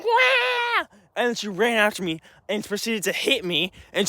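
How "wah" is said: sudden high mock wail; a shrill imitated shriek of a tantrum; retold scream. Sister screaming as recording starts, mock wail. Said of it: "she's screaming".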